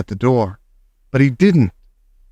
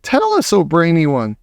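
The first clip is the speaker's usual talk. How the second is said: higher, eager witch cry; crying out eagerly; excited witch audience; eager witches; 5 semitones higher than usual. Acted. Witches beg.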